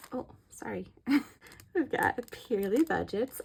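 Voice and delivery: usual speaking voice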